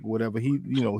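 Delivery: usual speaking voice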